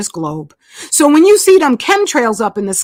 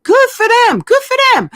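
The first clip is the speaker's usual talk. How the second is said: high mock cheer, much higher; sarcasm, pitched way up; sarcastic; 10 semitones higher than usual. Real, not acted. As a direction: toss it off in sarcastic approval. Mocking praise of Bud Light.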